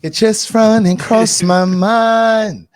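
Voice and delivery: slow, high sung-out line; [name] quote. Retold or imitated. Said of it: "That was [name] that said that".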